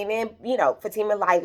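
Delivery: usual speaking voice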